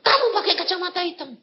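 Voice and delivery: high, rapid pointing-out; retold scolding. Guide telling the man about his dark glasses, fast high scolding. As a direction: tell him off quickly, pitched high. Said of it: "Saya bilang".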